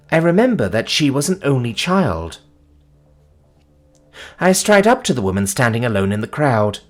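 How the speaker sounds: ordinary delivery, nothing marked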